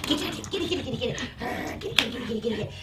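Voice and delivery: soft, breathy rapid urging; hushed repeated prompts; teasing the dog. Real, not acted. Luring dog toward the toy.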